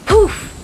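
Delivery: high, loud burst of "Poof!"; a sudden magical puff. A narrator's reading. The hermit turns the couple back into worms with a loud poof.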